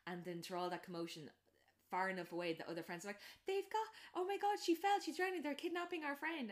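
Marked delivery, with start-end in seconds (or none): 3.5-6.5 much higher, louder frantic cry; imitating panicked college kids; mock panic. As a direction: shriek that your friend is taken. Friends panicking from afar.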